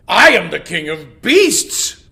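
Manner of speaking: loud proud roar; king proclaiming himself; roaring lion. Acted. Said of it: "roared the lion".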